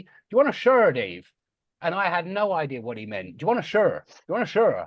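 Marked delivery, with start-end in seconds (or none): 0.0-1.3 one-syllable Irish "shirt" for shower; imitated Irish accent. Student quoting girlfriend's dad offering a shower, imitated Irish accent. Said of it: "and he said to me". 3.4-4.9 Irish "shirt" for shower, squashed into one syllable; imitated Irish accent. Girlfriend's dad repeating his offer to baffled guest, imitated Irish accent. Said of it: "he kept saying".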